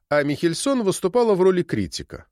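plain reading voice

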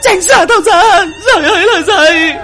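high, loud tearful scream; crying, wailing. Acted. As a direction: shriek through sobs to drive it away. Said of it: "miệng cứ thế mà kêu khóc".

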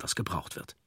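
ordinary delivery

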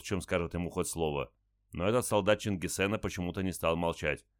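plain reading voice

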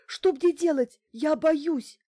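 high, loud panicky plea; near hysterics. A narrator's reading. Bankina begging for help by phone, near hysterics. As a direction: pitch it right up, frightened and loud. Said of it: "По голосу Банкиной я поняла, что она близка к истерике".

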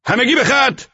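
shouted command. A narrator's reading. Driver orders the guards to line up, shouting. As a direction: call them into line. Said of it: "راننده فریاد زد".

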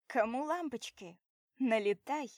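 loud call to the guests; shouting. Acted. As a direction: shout it across the party. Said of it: "и кричу".

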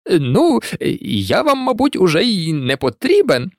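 hesitant, halting excuse with a forced smile; faltering, nervous attempt at lightness; weakly trying to smile. A narrator's reading. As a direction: stammer out an uneasy bid to leave. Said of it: "спробував усміхнутися Локарт".

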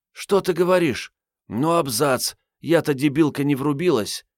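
put-on young woman voice. A narrator's reading. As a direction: exclaim like a cheeky girl. Marietta voiced, surprised.

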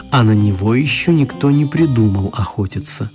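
usual speaking voice

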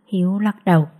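plain reading voice

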